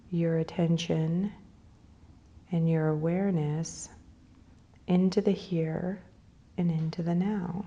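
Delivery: unhurried phrases, leaving space to breathe; guided meditation. Real, not acted. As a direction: lead them gently into breathing and imagery.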